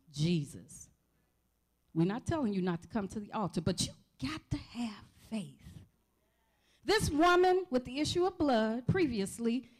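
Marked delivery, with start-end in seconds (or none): 3.6-5.9 whispered aside. Real, not acted. Preacher drops to an aside.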